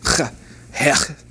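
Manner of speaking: throaty ch sound, "ch" then "hech"; teacher modelling "hech" with a throaty ch; pronouncing ch. Shown as a demonstration. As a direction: rasp the "ch" from the back of the throat. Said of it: "This is a letter that's pronounced ch".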